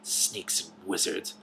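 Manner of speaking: slow, soft, hushed snake voice; soft hiss. A narrator's reading. Hidden snake muttering to itself, soft slow hiss. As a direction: hush it out like a snake in the weeds. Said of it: "whispered the soft voice".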